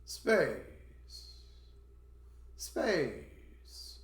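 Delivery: "space" starting high and sliding down; isolated "space" with an exaggerated fall; rising-falling intonation. Shown as a demonstration. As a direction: swoop downward through "space".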